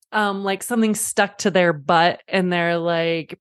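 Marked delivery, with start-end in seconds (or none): none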